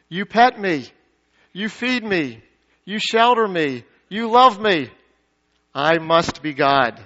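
smug cat logic, each phrase falling; self-centered cat. Acted. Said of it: "A cat says".

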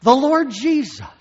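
loud, high proclamation of the name; loud high preacher's call; proclaiming. Acted. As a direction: ring out the name to the congregation. Preacher announcing Jesus' words.

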